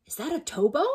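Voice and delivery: a small puzzled skeleton asking; little skeleton. Acted. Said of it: "the little skeleton said".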